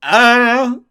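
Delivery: high, loud heroic dad voice; hammed-up heroic father; determined dad. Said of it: "And he's like".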